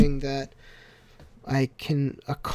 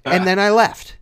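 excited storytelling; 8 semitones higher, 5 dB louder than usual. Real, not acted. Excited retelling of puking.

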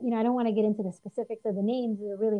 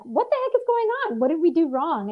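sharp high cry of alarm, then settling; suddenly scared and baffled as the cop pulls you over; alarmed disbelief; 7 semitones higher, 4 dB louder than usual. Retold or imitated. Girlfriend startled at a traffic stop, high alarmed disbelief. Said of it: "I'm like".

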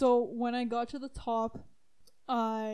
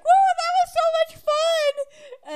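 much higher, louder, stretched-out delight; thrilled squeal; 9 dB louder, slower than usual. Retold or imitated. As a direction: squeal like a friend after a fun ride. Friend thrilled after ride.

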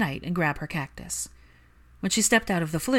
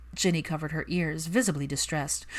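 ordinary delivery

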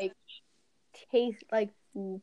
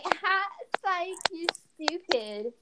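high, drawn-out British taunt; slow, pitched-up bad British accent; mock British accent; 8 semitones higher, much slower than usual. Chav girl withdrawing her offer, mock British accent. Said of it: "I'm giving them an accent".

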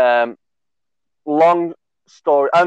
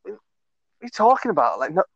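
high, incredulous protest; can't believe what the mate just said, pitch up; disbelieving; 6 semitones higher, faster than usual. Retold or imitated. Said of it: "And I was like".

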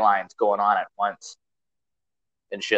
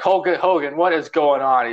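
high, loud urgent questioning; backstage interviewer; 6 semitones higher, 4 dB louder than usual. Retold or imitated. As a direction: demand answers from the champ. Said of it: "He's like".

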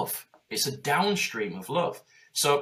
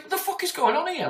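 baffled outburst. Retold or imitated. Business owner asked about appreciation, shocked high outburst. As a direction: act caught out and bewildered.

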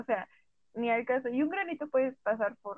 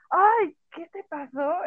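loud, drawn-out "Ay" rising high, slow and dramatic; alarmed nosy tone; 6 semitones higher, 7 dB louder, slower than usual. Shown as a demonstration. Friend spots a pimple and asks, emphatic and alarmed. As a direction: make the question sound like something's wrong. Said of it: "el tono de tu comentario suele hacer la diferencia".

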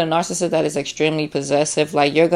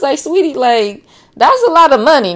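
much higher and louder, sassy indignant rant; sassy outraged rant; 13 semitones higher, 4 dB louder than usual. Pretend. To the greedy friend asking for money, high sassy outrage. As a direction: squeal your outrage like a scandalised diva.

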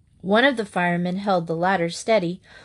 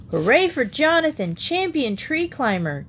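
cheering call, pitched up high; shouting; 6 semitones higher than usual. Acted. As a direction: cheer it out for the hero. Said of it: "George O'Connor shouted".

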